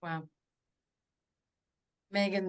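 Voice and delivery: normal talking voice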